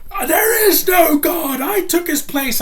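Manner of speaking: hammed-up villain shout, very high and loud; overacting villain. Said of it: "stupid accent like that or overacts".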